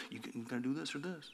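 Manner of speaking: very soft, quick imitation of someone pressing; low-volume, hurried acted ultimatum; hushed mock pressure. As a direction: press the choice under your breath, quickly. Voices people pressing a limited choice.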